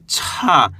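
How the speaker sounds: "छा" as ch with a long puff of air; drawn-out, aspirated "छा"; letter छा demo. Shown as a demonstration. Teacher reading out छा for beginners, letter demo. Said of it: "इसको अपन पढ़ेंगे".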